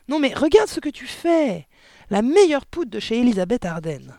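high, loud scolding wife; outraged yelling. A narrator's reading. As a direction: wail angrily over the waste. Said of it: "hurla la mère". (hurlements aigus, outrés)

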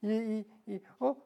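stammering, then a loud panicked cry. Acted. The wolf scares himself with his own story and panics, shouting. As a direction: stutter then blurt it out in fright.

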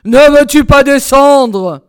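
slow, booming demand; roaring tyrant husband; angry shouting. A narrator's reading. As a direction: bellow impatiently at your wife. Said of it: "criait la Barbe-Bleue".

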